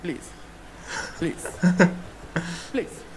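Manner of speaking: repeated please. Retold or imitated. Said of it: "his favorite word was "please".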